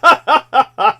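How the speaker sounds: a loud high cackle bursting out; loud burst of laughter. Real, not acted. Host cracking up at his own blackface remark, loud cackle.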